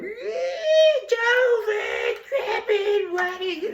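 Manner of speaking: very high, drawn-out squeal of a singer; mock a partner's strangled, squeaky singing; squeaky strangled kid. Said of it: "squeaky voice that sounded like a little kid being strangled".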